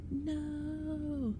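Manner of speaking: sharp high cry of horror; horrified howl. A narrator's reading. Boy howling in horror as masked intruders burst in. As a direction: yelp it out, suddenly terrified. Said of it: "Lee let out a horrified howl".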